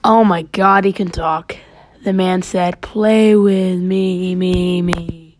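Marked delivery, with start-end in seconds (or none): 2.8-5.4 slow, drawn-out spooky echo; ghost boy. Acted. Ghost boy asking the man to play, slow and echoing. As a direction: stretch the words and let them echo away. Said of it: "The ghost boy repeated".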